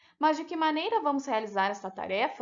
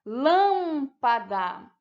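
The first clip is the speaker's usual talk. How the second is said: "Lâmpada" slow, long stressed "Lâm", then pa-da; syllable by syllable; 4 dB louder, much slower than usual. Shown as a demonstration. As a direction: stretch the first syllable of "Lâmpada". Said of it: "separada em sílabas".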